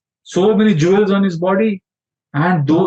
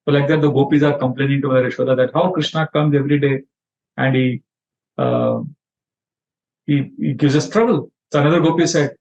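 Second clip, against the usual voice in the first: ordinary delivery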